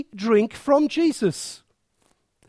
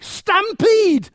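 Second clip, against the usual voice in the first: excited cry; 9 semitones higher, 4 dB louder than usual. Pretend. Preacher imagining listeners rushing forward, excited high cry. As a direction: pitch jumps way up, louder.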